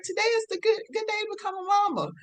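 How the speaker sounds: high, quick, delighted announcement; smiling declaration. Retold or imitated. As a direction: say it like a happy realisation, grinning. Repeats it, smiling.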